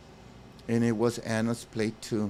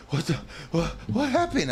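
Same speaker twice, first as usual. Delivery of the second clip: shocked; 6 semitones higher, faster than usual. Acted. Jack stumbling on the bloody scene, shocked. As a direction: blurt it out in alarm. Voicing Jack's shocked line.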